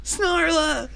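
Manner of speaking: high, hammed-up lover's call; anguished cry. Companion calls to his darling, melodramatic high cry. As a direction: wail loudly for a lost love. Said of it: "And he's like".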